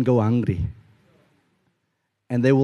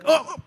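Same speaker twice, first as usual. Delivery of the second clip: high, short acted "oh!"; mock-startled yelp on seeing someone behind you; startled gasp; 13 semitones higher than usual. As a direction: act a sudden high gasp of surprise. Startled on turning round and seeing.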